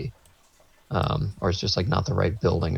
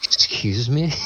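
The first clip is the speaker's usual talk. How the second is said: higher-pitched disbelieving question; incredulous; 5 semitones higher, slower than usual. Retold or imitated. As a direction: retort in mock disbelief. Teller's incredulous reply to guard.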